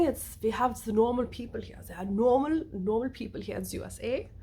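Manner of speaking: put-on German accent; playful German-accented statement. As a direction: say it as a mock German. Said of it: "There's normal people here".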